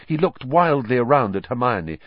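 plain reading voice

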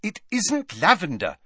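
high, exasperated retort; impatient. A narrator's reading. Hermione to the boy hiding behind her, impatient. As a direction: put him straight, out of patience. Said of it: "said Hermione impatiently".

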